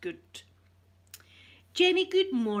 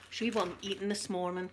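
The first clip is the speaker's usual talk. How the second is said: ordinary talk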